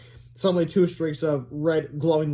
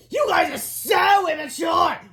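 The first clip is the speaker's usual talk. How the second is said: high, drawn-out indignant shout; 13 semitones higher, 4 dB louder, slower than usual. A narrator's reading. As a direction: stretch out the scolding at the top of the voice. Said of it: "shouted Melvin Sneely".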